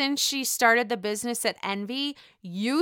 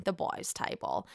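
breathy whispered repeat, much softer; whispered aside; 11 semitones lower, 10 dB quieter than usual. Shown as a demonstration. Whispering to viewers about the boys' table. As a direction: drop to a secret whisper.

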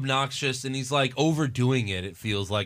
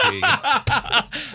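high, drawn-out singsong offer; acted handover; 11 semitones higher, much slower than usual. Diner leaving a fried pickle as a tip, hammed-up. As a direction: stretch it out slowly, pitched way up. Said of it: "I'm like".

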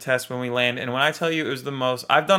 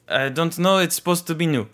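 put-on higher-pitched French-accented manager; 4 semitones higher than usual. Hotel manager unsure about the hotel, higher French accent. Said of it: "some French guy".

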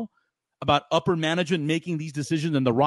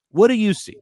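higher and louder, pressing; emphatic repeat; 7 semitones higher, 7 dB louder than usual. Real, not acted. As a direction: push the question hard. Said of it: "Tell me, what do you see?".

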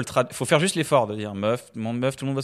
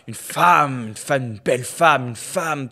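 savouring each mention, lightly grand; mock reverence; slower than usual. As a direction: admire her with a touch of pomp. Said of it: "ça la sacralise magnifiquement". (admiration légèrement pompeuse)

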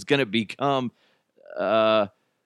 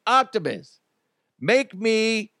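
higher-pitched, drawn-out command; robot owner; 9 semitones higher, slower than usual. Acted. Owner asks his robot for a sandwich, high commanding call. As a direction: call out an order to the household robot.

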